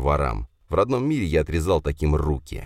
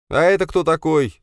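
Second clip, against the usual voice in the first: surprised question; 12 semitones higher, 4 dB louder than usual. Acted. Hero spots a stranger.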